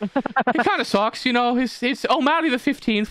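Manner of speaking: high, slow comic villager; mock-Irish shrugging regret; resigned Irish villager. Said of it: "them being like".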